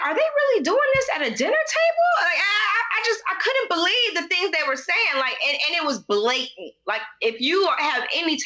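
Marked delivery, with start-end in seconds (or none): 0.1-2.1 high, shouted disbelief; shocked. Retold or imitated. Viewer shocked at the dinner table fight, shouting. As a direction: cry it out in outrage. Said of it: "I was like".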